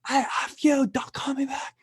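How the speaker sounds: high mock-shouted old lady cursing; swearing old grandma. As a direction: play a cranky eighty-year-old grandma swearing at a caller. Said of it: "eighty-year-old grandma swearing like a sailor".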